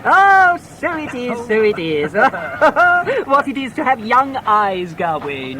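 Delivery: high, loud laughing exclamation; feigned delight. Acted. Sharper laughing at the boy he lost to, mock delight. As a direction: ham up the surprise with a laugh.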